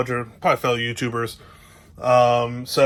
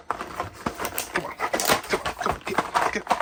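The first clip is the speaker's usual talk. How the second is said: straining under breath; 5 semitones higher, 6 dB quieter, much slower than usual. Real, not acted. Struggling one-handed with cover.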